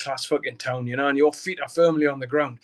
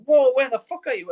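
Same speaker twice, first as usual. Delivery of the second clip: startled yell; 8 semitones higher, 7 dB louder than usual. Retold or imitated. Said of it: "I was like".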